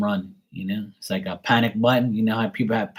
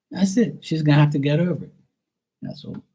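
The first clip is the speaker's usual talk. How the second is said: ordinary talk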